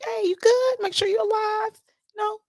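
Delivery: high, singsong check-in. Retold or imitated. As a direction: imitate a breezy, high-pitched checking-in message. Voicing her friendly check-in message.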